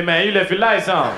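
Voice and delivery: loud scolding car voice. Retold or imitated. The talking car scolds its driver about lights, loud. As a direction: yell it like the car nagging.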